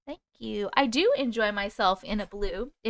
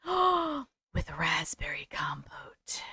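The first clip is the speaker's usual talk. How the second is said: whisper; 5 semitones lower, 7 dB quieter, much slower than usual. Acted. Reading comment in whisper.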